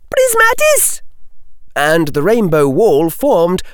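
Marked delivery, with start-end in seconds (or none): 0.1-1.0 very high-pitched incantation cry; shouted spell. A narrator's reading. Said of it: "and Pavati shouted".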